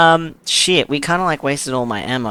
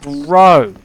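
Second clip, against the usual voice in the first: loud shocked outburst; startled shout; 3 semitones higher, 10 dB louder than usual. Real, not acted. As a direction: yell it at the scare. Jump scare in game.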